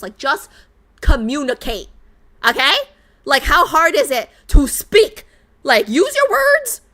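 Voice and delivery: scolding the game characters, exasperated and loud; exasperated rant. Real, not acted. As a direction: vent at the screen like you've had enough. Ranting at game couple's miscommunication.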